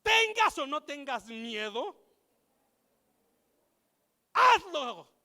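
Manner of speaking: building to a shouted ¡Hazlo!; rally the congregation with a big shout; loud declaration. Shown as a demonstration. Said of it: "Pon tu mano derecha en la persona de al lado y dile".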